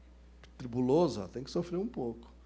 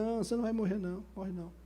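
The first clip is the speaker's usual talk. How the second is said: mock reassurance; 6 semitones higher, much faster than usual. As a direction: hurriedly talk a dramatic man down, imitated. Pastor hushes the distraught man.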